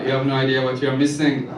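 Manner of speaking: ordinary talk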